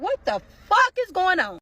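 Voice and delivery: high, slow exclamation of disbelief; retold alarm. Woman seeing swat teams outside, retold alarm. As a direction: drag out the words in acted shock. Acting shock at tanks and guns.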